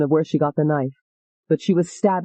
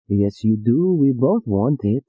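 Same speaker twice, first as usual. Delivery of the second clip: low insisting man; 6 semitones lower, slower than usual. Acted.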